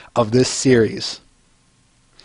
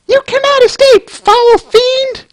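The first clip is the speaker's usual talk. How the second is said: very high, louder heroic falsetto; terrible female voice; 3 dB louder than usual. Acted. Heroine pony confronting the fiend, high falsetto. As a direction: proclaim it in a shrill heroic squeak. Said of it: "my terrible female voice".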